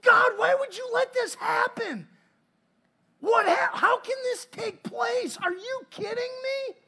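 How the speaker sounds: high, loud, pleading lament; anguished cry to God. Retold or imitated. Elders mourning the defeat cry out to God, anguished shout. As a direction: cry out to God in grief, slowing at the end. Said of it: "and they're like".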